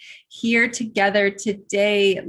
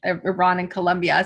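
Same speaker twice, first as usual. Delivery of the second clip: usual speaking voice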